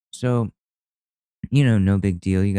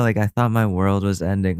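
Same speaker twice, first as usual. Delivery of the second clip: ordinary talk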